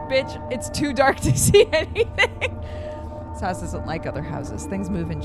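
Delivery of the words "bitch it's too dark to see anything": laughing loudly through the line. Real, not acted. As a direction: crack up while saying it. Reading joke line while laughing.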